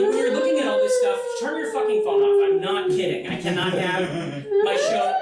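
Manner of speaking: ranting at the audience about phones going off; mock rant. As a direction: scold the crowd in a hammed-up fury.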